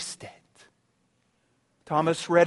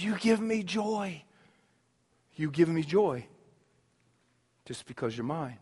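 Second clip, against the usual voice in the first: soft, slow and lower, a father blessing his son; tender voice of God; 4 semitones lower, 3 dB quieter than usual. Acted. God the Father tenderly blessing Jesus at his baptism, softly. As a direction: gently speak a father's delight.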